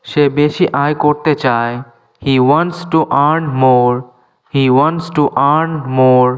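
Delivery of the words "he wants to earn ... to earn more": slow, clear English for learners; careful dictation pace; slow teaching demo. Shown as a demonstration. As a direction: spell each word out slowly for students. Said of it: "teacher repeats example slowly".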